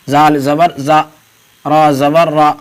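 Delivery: each letter name, then zabar, then the vowel sound, spaced out; letter-by-letter drill. Shown as a demonstration. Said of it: "دیکھیے آ کی آواز نکل رہی ہے".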